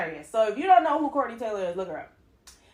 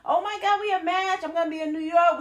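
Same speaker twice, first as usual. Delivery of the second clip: high, loud, gushing greeting; mock over-excited cousin, voice way up; 10 semitones higher, 4 dB louder than usual. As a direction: greet a long-lost relative over-eagerly, pitched high. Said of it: "the cousin emailed her, be like".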